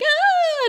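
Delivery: a sudden high, loud wail of despair; despairing cry. Retold or imitated. Willow breaking down at the sight of Buffy, high loud despairing cry. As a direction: let out a short high anguished cry. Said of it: "And she's like".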